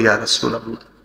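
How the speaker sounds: low, subdued voice of a weeping man; understated tearful murmur; tearful reply. Retold or imitated. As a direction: answer softly and humbly through imagined tears. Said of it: "চোখের পানি ছেড়ে দিয়ে বলি".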